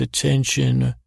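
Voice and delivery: normal talking voice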